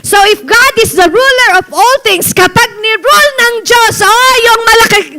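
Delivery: fiery preaching. Acted. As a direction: preach it out to the whole church. Preaching at full force. (pastor to congregation, nag-aalab na pangangaral)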